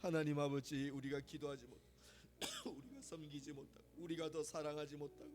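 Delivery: from a murmured confession down to near silence; fading prayer. Acted. Prayer subsides, quieting.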